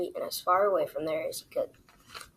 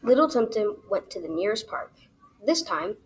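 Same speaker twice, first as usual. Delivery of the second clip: ordinary delivery, nothing marked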